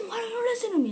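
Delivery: high, loud frustrated shout; exasperated yell. Retold or imitated. Kid yelling at a movie character, exasperated. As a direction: yell it like nobody listens.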